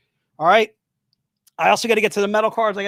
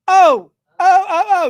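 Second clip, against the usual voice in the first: much higher, louder excited yelps; 10 semitones higher, 7 dB louder, slower than usual. Real, not acted. Host thrilled to greet Chuck, excited yelps. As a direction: squeal it out with joy.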